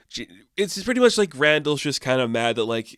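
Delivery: ordinary delivery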